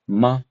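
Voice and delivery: ma short and level; a quick, level ma at the end; short flat tone. Shown as a demonstration. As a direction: keep ma brief on an even pitch. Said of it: "is shorter and lighter".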